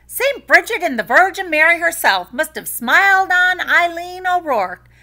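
high, loud Irish village woman; gossiping village woman. A narrator's reading. Village woman gossiping about Eileen, high and bright. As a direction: gossip with relish.

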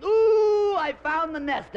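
high, loud whoop; a gleeful husband shrieking his find; excited husband scream. Retold or imitated. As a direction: yell it in triumph.

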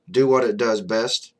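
ordinary talk

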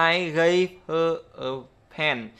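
"I gave her a" drawn out slow, H in "her" sounded; teacher spells it out slowly for students, word by word; slow unlinked demo. Shown as a demonstration. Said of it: "chúng ta sẽ thấy là".

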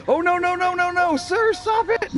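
high, panicked pleading; real panic. Real, not acted. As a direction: squeal it as the attacker bears down. Rival charges at him in game.

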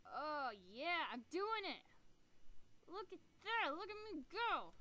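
loud, high gleeful whoops; excited cheering. Real, not acted. Gamer cheering her own surgery success, loud excited whoops. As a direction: crow with delight at the win.

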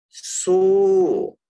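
"so" hit high and loud; "so" set apart, high and loud, meaning "in the same way"; stressed so. Shown as a demonstration. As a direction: punch the word "so". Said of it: "so here means in the same way".

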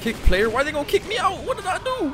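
high, outraged disbelief; indignant disbelief. Real, not acted. Player protesting a vote to kick him, outraged disbelief. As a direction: protest being kicked unfairly.

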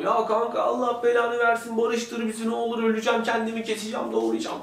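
high-pitched, hammed-up wailing plea; mock crying. Ahmet begging his friend to patch things up, mock crying. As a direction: wail it in one high, pretend-sobbing stream. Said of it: "Ahmet de ağlıyor".

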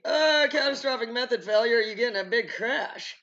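mock alarm. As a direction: blurt out the worst case dramatically. Acting out worst case answer.

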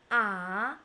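"a" sliding upward; second tone. Shown as a demonstration. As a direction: lift "a" from a low start. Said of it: "four tones in Chinese pinyin".

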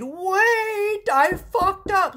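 high, loud alarmed "Wait"; sudden realization. Real, not acted. Reader realizing she pulled the wrong card, startled and loud. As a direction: catch your own mistake mid-sentence.

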